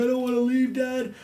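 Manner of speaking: mock childlike whine pitched very high; begging child. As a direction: plead like a small boy to his dad. Child begging to stay with dad.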